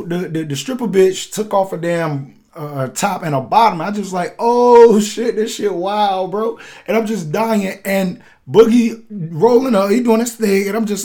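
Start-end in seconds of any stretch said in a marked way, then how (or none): 4.4-6.6 amazed exclamation. Retold or imitated. Reacting amazed to a stripper undressing.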